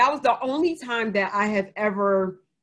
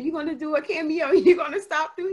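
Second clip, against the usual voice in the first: high, laughing playful question; giggling while asking; laughing tease; 7 semitones higher than usual. Retold or imitated. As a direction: tease him through a laugh. Teasing brother about a cameo.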